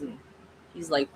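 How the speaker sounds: ordinary delivery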